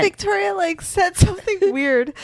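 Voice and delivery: high voice breaking into giggles; laughing. Real, not acted. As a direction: talk through a laughing fit. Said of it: "What are you laughing at?".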